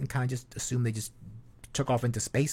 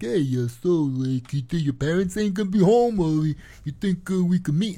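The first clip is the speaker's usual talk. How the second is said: louder creepy come-on, mock sleazy; hammed-up sleazy Long Island guy; pervy guy; 6 semitones higher, 5 dB louder than usual. Predator texting a girl, mock sleazy and louder. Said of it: "some pervy guy from Long Island would be like".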